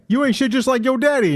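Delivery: high, mock-scolding parent voice; hammed-up parental jab. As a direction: scold like a bitter mom.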